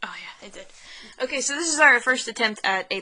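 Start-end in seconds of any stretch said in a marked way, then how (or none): 0.0-0.7 hushed whisper while recording starts; whispering to her co-host. Real, not acted. Checking the mic with her co-host, whispered.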